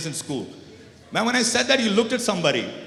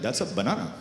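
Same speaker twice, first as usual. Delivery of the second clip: put-on thick Desi accent; 11 semitones lower, 4 dB quieter than usual. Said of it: "with a very thick Desi accent".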